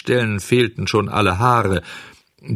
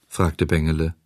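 ordinary delivery, nothing marked

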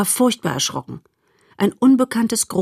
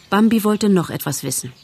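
ordinary delivery, nothing marked

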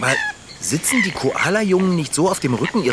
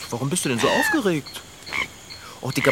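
usual speaking voice

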